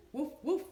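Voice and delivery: loud, high barked woofs; dog barking. A narrator's reading. Dog answers Benny with loud high barks. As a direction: bark like an eager puppy. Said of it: "said his dog".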